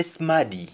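"smaddy" said SMA-dee, short a; "smaddy" in Jamaican patois; Jamaican pronunciation. Shown as a demonstration. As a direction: say "smaddy" for somebody. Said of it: "How do you say somebody in Jamaican?".

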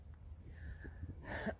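quiet breathy chuckle; a little laugh to himself; soft laugh. Real, not acted. Amused at goofy dome.

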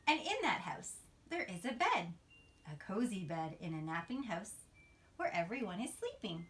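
put-on sing-song storybook read-aloud for little kids; reading to children. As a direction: read it like a bedtime picture book, rising on each new animal. Reads picture book to children.

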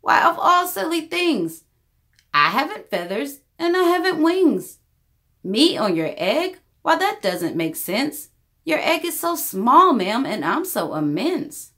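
lightly voiced elephant, chuckling disbelief; a big gentle elephant amused by the idea; mildly laughing elephant. A narrator's reading. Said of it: "The elephant laughed".

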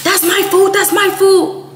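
high-pitched mocking imitation of a friend; high, insistent mock protest; possessive protest. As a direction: mimic her squealing over food. Mimics friend guarding her food.

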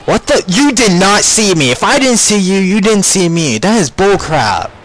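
high, loud indignant protest; indignant outburst. Real, not acted. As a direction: argue with the game, outraged. Outraged at being spotted.

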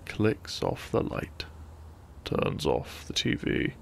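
usual speaking voice

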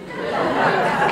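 a quick real laugh, falling; short laugh. Real, not acted. Laughing at her own war-music pick, real laugh. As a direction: laugh at your own answer. Laugh after the band name.

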